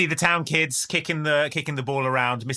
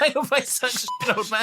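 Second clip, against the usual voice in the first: mock kid taunt; 8 semitones higher than usual. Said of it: "the kid shouted".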